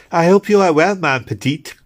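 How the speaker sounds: put-on sweet, fussing matron greeting a girl; kindly nurse. A narrator's reading. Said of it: "she said kindly".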